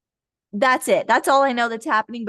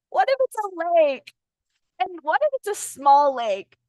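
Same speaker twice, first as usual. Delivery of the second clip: higher, put-on fretting voice easing back down; mock worrier; 6 semitones higher than usual. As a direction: keep piling up timid doubts in a mimicking voice. Voicing hesitant listener.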